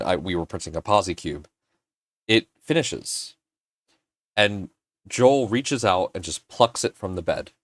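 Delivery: normal talking voice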